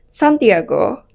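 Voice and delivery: ordinary talk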